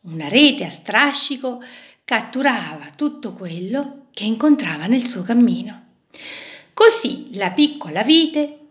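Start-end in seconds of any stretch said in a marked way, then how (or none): none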